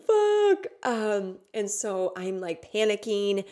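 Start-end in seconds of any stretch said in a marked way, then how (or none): 0.1-0.7 high, loud wailed curse; acted-out cry of dread; re-enacted tearful panic. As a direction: wail it as if crying with fear. Re-enacting terrified pleading before the ceremony.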